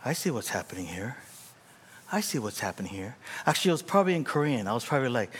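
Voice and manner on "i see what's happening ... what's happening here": low, hushed mutter to himself; muttered realization. Retold or imitated. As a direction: drop right down, as if privately catching on. Walking away, retelling bitter realization.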